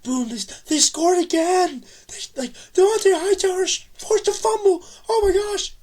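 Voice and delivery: high, loud, ecstatic yelling; thrilled yelling. Real, not acted. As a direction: relive the comeback at full volume. Reliving comeback touchdowns.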